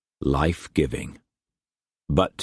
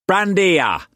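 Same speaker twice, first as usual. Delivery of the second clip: put-on much higher, hammy old sailor voice; old sea-dog Brock; 13 semitones higher, 4 dB louder than usual. Brock welcoming Struan to his table, hammed-up old sailor. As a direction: boom it out like a rough old trader.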